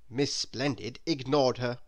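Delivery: ordinary delivery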